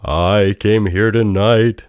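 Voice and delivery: loud, high, slow spectral voice; booming ghost. A narrator's reading. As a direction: boom it out like a phantom. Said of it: "said the ghost".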